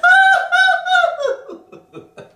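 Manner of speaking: very high, loud hooting laugh; head-voice laugh. Shown as a demonstration. Coach ending her climbing laugh, high hoot. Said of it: "ça finit dans la tête".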